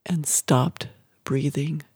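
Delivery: usual speaking voice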